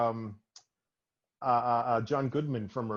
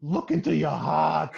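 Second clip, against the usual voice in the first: higher and louder intense movie voice; impassioned Turturro impression, pitched up and loud; John Turturro impression; 5 semitones higher, 7 dB louder than usual. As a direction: do Turturro pleading to look into your heart. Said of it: "John Turturro has a thing where he goes".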